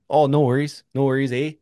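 loud, high Canadian "No worries, eh?"; Canadian accent. Shown as a demonstration. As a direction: ham up the Canadian "eh". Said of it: "re-say it in a Canadian accent".

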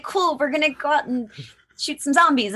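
high goofy imitation of the guys; lightly mocking the excited gamers; mock gamer bro. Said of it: "and you guys are like".

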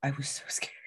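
hushed admission of fear; a whispered confession of fright. Real, not acted. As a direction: whisper it, still shaken. Admitting fear after the story.

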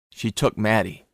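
plain reading voice

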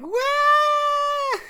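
put-on high squealing drunk "wah"; drunk girl yelp. Imitating the drunk girl's high squeal as she flings her friend. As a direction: shriek it like a drunk girl mid-dance.

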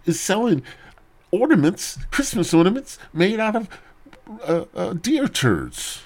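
high, incredulous whine of a churchgoer; acted exasperation. Acted churchgoer appalled by the stalls.

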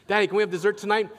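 put-on wheedling kid voice, quick and high; kids begging. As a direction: beg like a child who smells weakness. Kids begging again for dessert.

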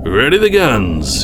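slow, drawn-out battle cry; shouted order. A narrator's reading. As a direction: call it out to a bridge crew. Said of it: "he shouted".

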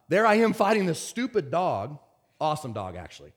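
normal talking voice

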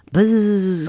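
a light buzzing fly; mild buzz of a fly. A narrator's reading. Blue fly answering Simpkin, light buzz. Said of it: "quoth the blue fly".

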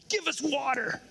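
high, pushy demand. Retold or imitated. People demanding from Moses, mock demanding high voice. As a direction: bark it high and entitled. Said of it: "That's how it sounded".

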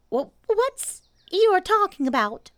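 ordinary delivery

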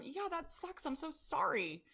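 high, sing-song sympathy; sympathetic comfort. Pretend. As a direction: coo it like you're consoling a friend. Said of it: "I wanna tell them".

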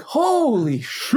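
high, drawn-out disbelief; mock astonishment. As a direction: stretch it out in exaggerated shock. Retells stunned reaction to wrong guess.

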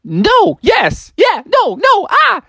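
very high, flustered yelps; hammed-up frightened frog; panicked Froggy. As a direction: squeal each answer in a comic panic. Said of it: "And Froggy was like".

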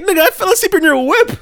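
high, loud squeaky "bro, stop, you're good"; excited high reassurance. Retold or imitated. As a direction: brush off the apology in a squeaky high voice. Excited laughing reassurance to dad.

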